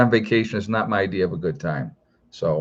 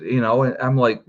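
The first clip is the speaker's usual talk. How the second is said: ordinary talk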